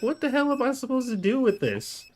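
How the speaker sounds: hammed-up shock at the mess handed over; high-pitched exasperated question; incredulous outburst. His stunned reply to wife.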